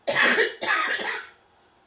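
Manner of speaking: very loud, hacking choking cough. Real, not acted. Still choking on tea, a loud hacking cough. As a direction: cough violently, clearing the throat. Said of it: "When I'm done choking".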